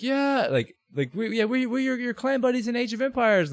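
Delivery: high, soft excited reply; a hint of eager clanmates; eager fans. Clanmates explain who they are to the player. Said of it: "And like".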